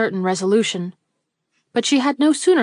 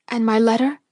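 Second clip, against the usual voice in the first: ordinary delivery, nothing marked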